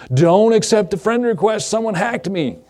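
hammed-up panic about being hacked; mock alarm. Preacher mimics hacked people warning friends, mock alarm. Quoting panicked Facebook posts.

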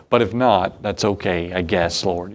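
grudging acceptance, sinking low; mock reluctant prayer. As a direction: give in half-heartedly, voice dropping away.

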